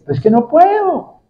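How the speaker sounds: a hint of a prophet pleading he simply can't; helpless prophet. Prophet Balaam tells King Balac he cannot curse, helplessly. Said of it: "le decía".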